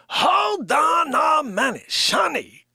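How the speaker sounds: slow, loud shouted call; loud drawn-out call to Sonny. Real, not acted. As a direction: yell it across the room, drawn out. Yelling to Sonny in room.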